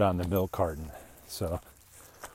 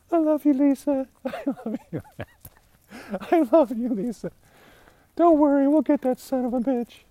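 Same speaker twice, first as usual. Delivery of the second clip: much higher, louder swooning lover voice through laughter; Strzok love-struck; 8 dB louder than usual. Retold or imitated. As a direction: ham up a lovesick agent cooing. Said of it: "mimicking Strzok and Page".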